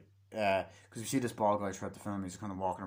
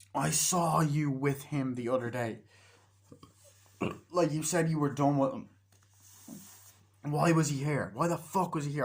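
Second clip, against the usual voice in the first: higher, pointed mock anger; acted accusation; 5 semitones higher, 3 dB louder than usual. Accusation repeated, retold quarrel.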